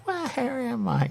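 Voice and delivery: a lightly voiced bewildered duck; confused duck. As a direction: drawl it out like a lost little duck. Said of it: "a duck going".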